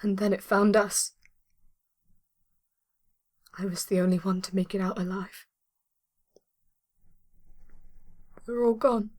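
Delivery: halting recollection with long pauses; grieving. Acted. Survivor telling rescuer how everyone died, halting grief. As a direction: tell it as if close to tears.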